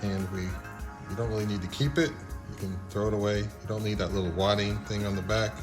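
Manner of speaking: ordinary delivery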